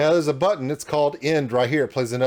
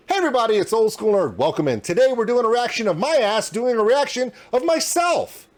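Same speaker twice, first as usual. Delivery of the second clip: mock intro; 6 semitones higher than usual. Shown as a demonstration. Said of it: "let's say I was suddenly doing an intro and I said".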